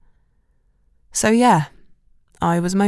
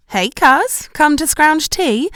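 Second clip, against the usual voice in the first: higher, louder smug teasing; smirking tease; 9 semitones higher, 5 dB louder, slower than usual. A narrator's reading. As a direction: relish the awkwardness with a grin. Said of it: "said Shelley with a smirk".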